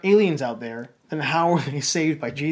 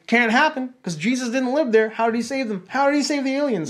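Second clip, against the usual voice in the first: high-pitched imitation of scandalized churchgoers; hammed-up high disbelief; mock incredulous objection; 6 semitones higher than usual. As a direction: protest in a high, mocking voice. Voices incredulous church people objecting.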